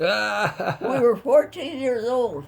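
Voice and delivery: laughing. Real, not acted. Laughing at their own naivety.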